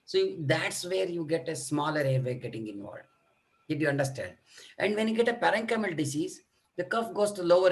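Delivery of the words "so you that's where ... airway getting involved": ordinary talk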